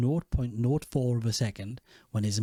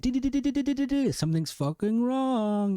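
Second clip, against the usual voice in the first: alarm bells ringing; 11 semitones higher than usual. Acted. Recapping alarm bells in his head, high mock alarm. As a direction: sound the alarm in a high, stretched voice. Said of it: "Bells in my head".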